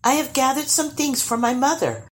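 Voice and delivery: put-on high, sweet girlish voice; sweetly. A narrator's reading. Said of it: "she added sweetly".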